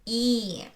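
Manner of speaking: "и", one held ee vowel; unstressed е as и. Shown as a demonstration. Teacher showing how unstressed е sounds, "и" as clear ee. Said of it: "it's pronounced as".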